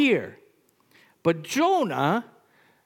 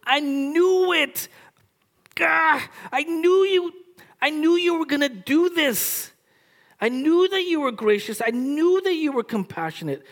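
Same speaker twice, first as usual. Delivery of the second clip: high, loud, furious whine at God; furious complaint; 9 semitones higher, 3 dB louder than usual. Acted. Jonah rages at God over his mercy, high and loud. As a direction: spit out every I knew like an accusation. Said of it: "that's how angry he is".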